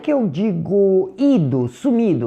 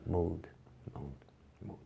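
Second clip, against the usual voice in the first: hushed, much softer practice repetition; very quiet; 10 semitones lower, 20 dB quieter than usual. Shown as a demonstration. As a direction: say it softly under your breath. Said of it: "Digam baixinho". (bem baixinho)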